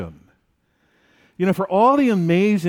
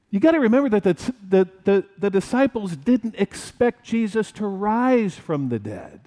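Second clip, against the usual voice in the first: ordinary delivery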